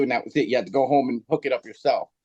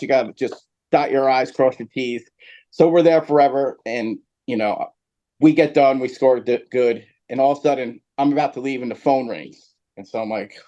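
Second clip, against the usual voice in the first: usual speaking voice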